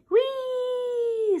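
high gleeful puppy squeal; puppy's whee. Acted. Scruff zooming past the counter, high gleeful whee.

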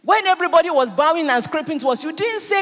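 climbing indignant challenge; rising loud rebuke. Acted. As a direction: press the accusation harder each line. Job rebuking his wife.

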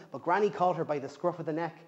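plain reading voice

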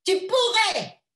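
high sharp cry at her daughter; shouting. A narrator's reading. Mother scolding her daughter, shouting. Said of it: "lui cria-t-elle".

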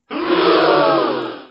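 high breathless gasp of shock; sharp high gasp. Acted. Friend reacting to flying button, shocked gasp. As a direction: gasp in amazement.

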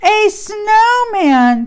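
high, loud, drawn-out delighted cry; excited. Acted. Cow seeing the tree for the first time, excited cry. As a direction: burst out with delight. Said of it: "said Cow excitedly".

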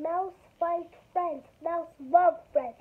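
usual speaking voice

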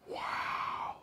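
breathy gasp into an airy wow; gasp, then let the word out on breath. A narrator's reading. Dentist inside the fox's mouth, airy gasp. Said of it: "he gasped".

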